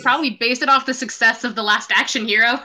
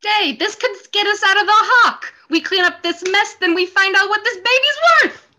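excited Mario; 6 semitones higher than usual. Acted. Mario pitches selling the locket.

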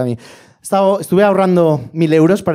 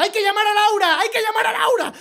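high, soft panicky mother voice; frantic mother; 4 dB quieter than usual. Retold or imitated. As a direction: squeak it out like a distraught mum. (madre angustiada con voz aguda y suave)

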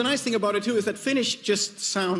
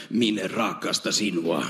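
much lower, gruff Finnish "Minä rakastan sinua"; Finnish phrase; 11 semitones lower than usual. Shown as a demonstration. Showing how Finnish "I love you" sounds, much lower. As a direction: drop deep to say the Finnish. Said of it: "It's, uh".

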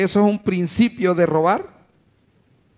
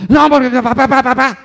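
high, loud imitation of a protesting kid; mock child clamoring for dad; noisy child; 8 semitones higher, 8 dB louder, faster than usual. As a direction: talk right over the parent, insistently. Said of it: "entra el hijo metiendo bulla".

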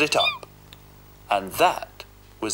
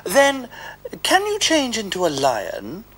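much higher, bright cat voice; Puss the cat; 11 semitones higher than usual. Acted. Puss baiting the ogre, high coaxing voice. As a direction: coax the ogre along.

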